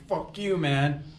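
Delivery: play-acted angry challenge; mock fuming outburst. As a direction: imitate a dad losing it, without shouting. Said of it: "the papa bear is fuming".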